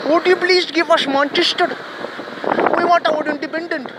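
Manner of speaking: high-pitched, put-on protest voice; mock screaming. Minority member demanding Manchester from the British, mock screaming. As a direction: imitate a clamouring demand, pitched well up. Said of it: "if I am screaming and speaking like this".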